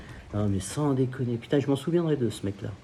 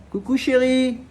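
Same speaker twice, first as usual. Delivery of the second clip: high, loud affectionate call; cheerful high hello to wife by the pool; 12 semitones higher, 6 dB louder, slower than usual. Real, not acted. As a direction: call sweetly across to her. Greeting his wife off-camera.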